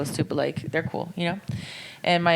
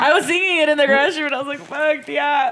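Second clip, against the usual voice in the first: excited retelling; 10 semitones higher, 7 dB louder than usual. Real, not acted.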